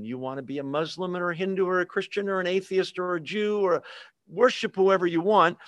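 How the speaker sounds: usual speaking voice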